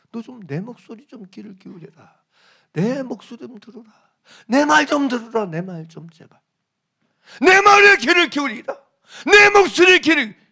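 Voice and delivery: pleading voice of God, rising in force. Acted. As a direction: beg them to listen, building up. Voicing God pleading to Israel. (백성에게 간청하는 하나님의 목소리, 점점 커지며)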